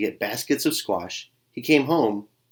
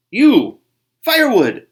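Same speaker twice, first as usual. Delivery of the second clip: high loud barked commands; gruff order; 13 semitones higher, 11 dB louder than usual. Acted. The varmint barks orders at Buddy, high and loud. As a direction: bark the orders out.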